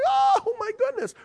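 high, loud squeal of delight; thrilled fan. Retold or imitated. Fan whose team just won, squealing with delight. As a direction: jump up and down with joy. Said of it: "when your team wins, you jump up and down".

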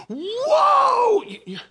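high shocked yelp at the sight; a sharp, very high frightened whoa; startled cry. Acted. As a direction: cry out in sudden alarm. People of Jericho peeking out.

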